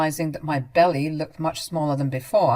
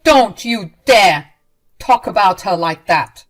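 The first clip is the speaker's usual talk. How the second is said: stern warning; 2 semitones higher, 11 dB louder than usual. Acted. Leon defending wife from mother.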